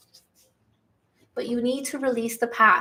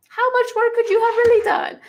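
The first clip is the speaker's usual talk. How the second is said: incredulous scoff; 11 semitones higher, 4 dB louder than usual. Real, not acted. Scoffing at the changed ex.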